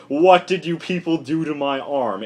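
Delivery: a hint of an angry superhero; mock outraged Hawkman. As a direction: play an upset hero waking up, lightly. Hawkman wakes, upset.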